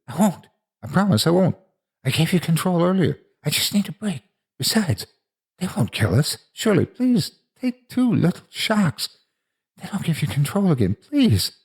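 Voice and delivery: put-on high-pitched, desperate child's plea; pleading. A narrator's reading. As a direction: plead urgently for a break. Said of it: "He pleaded".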